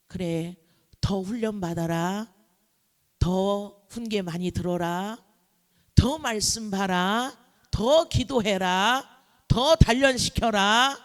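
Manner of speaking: slow, measured orders as God; God's commands. Acted. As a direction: stretch each command, building in force toward the end. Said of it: "그러면 하나님은 땡".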